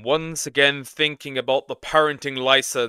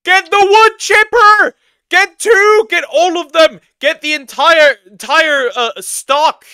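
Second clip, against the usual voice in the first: very high loud slow yell; angry yelling; 12 dB louder, slower than usual. Real, not acted. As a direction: scream it in outrage. Outraged yelling.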